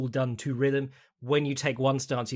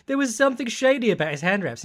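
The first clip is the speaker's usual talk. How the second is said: higher-pitched imitation of someone crying foul; mock indignant complaint; 8 semitones higher, 4 dB louder than usual. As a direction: make a mock accusation, pitched up. Mimicking a suspicious post-fight complaint.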